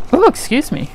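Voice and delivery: a hiccup jolts the words. Real, not acted. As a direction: caught mid-hiccup. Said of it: "I have the hiccups now".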